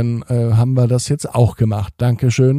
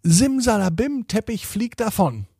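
magic spell; 10 semitones higher than usual. Acted. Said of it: "und sagte".

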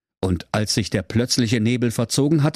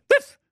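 very high yappy wiff; pug bark; 4 dB louder than usual. Acted. Brudi the pug handing over the crowbar, high yapping bark. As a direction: yap like a tiny pug.

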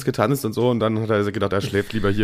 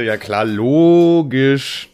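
sarcastic; 4 dB louder, much slower than usual. Acted. Ironic reaction to late wishes.

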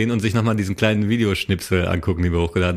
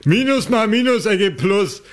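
put-on much higher, slow drunk bragging; drunk yelling outside; 11 semitones higher than usual. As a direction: brag like a drunk teen. S1 acts drunk driver. (betrunken grölend)

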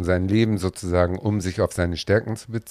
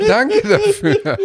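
slow, high, laughing it out; high laughing thanks; 6 dB louder, slower than usual. Real, not acted. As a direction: let the laugh take over the thanks. Repeated thanks with more laughter.

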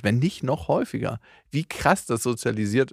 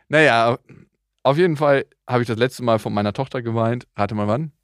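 ordinary talk, nothing marked

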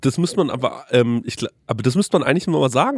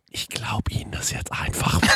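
toneless whisper; 3 semitones lower, 6 dB quieter than usual. Retold or imitated. Waitress giving up on the payment, whispered. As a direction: give in quietly.

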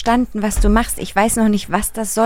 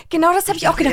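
normal talking voice

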